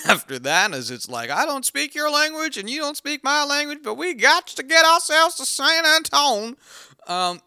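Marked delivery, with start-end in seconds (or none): 1.3-6.6 high, mocking movie-dialogue voice; hammed-up buddy-cop hero. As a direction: parody a corny buddy-cop line, pitched way up.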